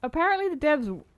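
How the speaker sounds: choked-up voice after coughing; talking right after a coughing fit. Real, not acted. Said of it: "I'm choking to death".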